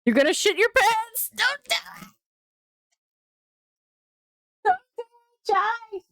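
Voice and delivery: very high-pitched, comic screaming; screamed away from the mic; mock scream. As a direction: shriek it as a frantic warning. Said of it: "I had to look away to scream".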